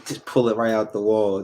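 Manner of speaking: normal talking voice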